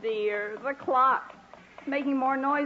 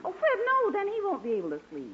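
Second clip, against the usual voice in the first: sudden high yelp of protest; alarmed protest; 9 semitones higher than usual. Acted. Wife stops husband's plan with a high alarmed cry. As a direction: jump in to stop him, pitched way up.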